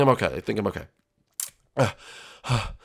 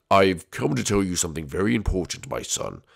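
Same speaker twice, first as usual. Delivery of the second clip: low, solemn dad voice; deep ghost dad, sinking lower; ghostly father; 5 semitones lower, 4 dB quieter than usual. Acted. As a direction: deliver a grave message from beyond.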